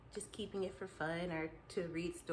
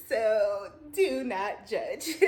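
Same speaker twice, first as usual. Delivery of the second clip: slow, louder, drawn-out "do not judge"; playful plea; 7 dB louder, much slower than usual. Real, not acted. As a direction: stretch it out teasingly. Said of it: "playful plea".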